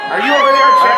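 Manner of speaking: loud high shout across the hall; calling from far away. Real, not acted. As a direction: call out to someone distant. Calling out for Chad in crowd.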